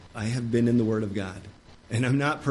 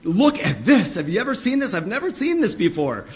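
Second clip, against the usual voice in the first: higher, louder eager call to a friend; excited calling; 6 semitones higher, 5 dB louder than usual. Retold or imitated. Preacher calling Melissa over to see a verse, eager and raised. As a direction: call her over, thrilled. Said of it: "I'll be like".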